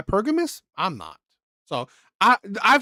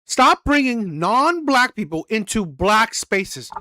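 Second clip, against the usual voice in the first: slow read-out; slower than usual. Real, not acted. Slowly re-reads the tweet.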